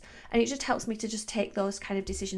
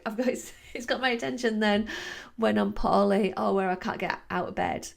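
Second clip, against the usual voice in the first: ordinary talk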